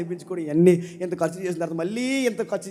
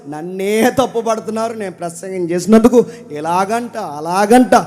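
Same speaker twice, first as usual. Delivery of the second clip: loud, fervent pulpit lament; impassioned preaching; 4 semitones higher, 13 dB louder than usual. Acted. Preacher voicing Jeremiah's complaint to the Lord, impassioned preaching. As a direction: pour out Jeremiah's grief to the Lord at full force.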